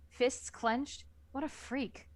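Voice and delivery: ordinary talk, nothing marked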